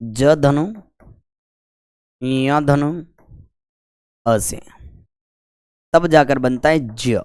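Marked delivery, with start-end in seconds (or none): 0.0-4.6 "ज", "या" and "अ" given one by one, long pauses between; "ज", then nasal-glide "या", then "अ", as separate pieces; sound-by-sound breakdown. Shown as a demonstration. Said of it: "ये बनता है कहीं से?".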